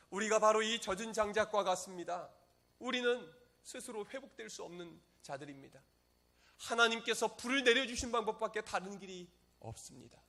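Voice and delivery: high, loud impassioned prayer; earnest pleading. Acted. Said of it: "간절히 하나님께 부르짖어 기도합니다".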